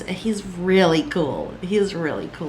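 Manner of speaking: ordinary talk, nothing marked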